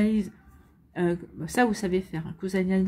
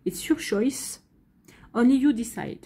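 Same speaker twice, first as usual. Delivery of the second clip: higher, playful English-accented line; mock-proud English accent, higher at the end; 4 semitones higher, slower than usual. Shown as a demonstration. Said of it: "Vous avez vu ce parfait accent anglais".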